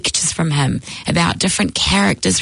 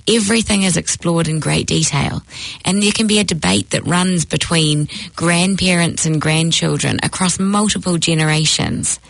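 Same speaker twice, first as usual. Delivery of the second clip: normal talking voice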